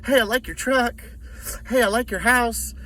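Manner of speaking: high, fake-friendly chitchat; hammed-up high doorstep flattery; mock salesman small talk. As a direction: imitate an over-eager salesman's compliments.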